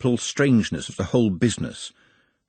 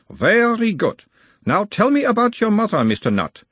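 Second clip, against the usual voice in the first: put-on a mild Uberwaldian accent, the higher voice of a gentle examiner; 12 semitones higher, 4 dB louder than usual. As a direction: question him in a light Uberwald accent, calming the patient. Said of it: "in a mild Uberwaldian accent".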